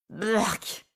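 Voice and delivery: disgusted blurk. Acted. Disgusted reaction.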